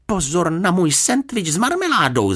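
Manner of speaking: high, urgent cry of alarm; shouted warning. A narrator's reading. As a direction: call out to stop her, pitched right up. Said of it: "zakřičel". (varovný výkřik)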